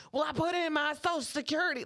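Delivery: Southern lady defending herself; Southern accent. Retold or imitated. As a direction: imitate an insistent Southern customer. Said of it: "I did the accent".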